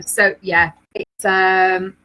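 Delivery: ordinary delivery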